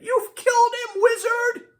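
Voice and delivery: very high, loud accusing shriek; high-pitched horrified outcry; Lydia crying out. A narrator's reading. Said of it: "cries Lydia".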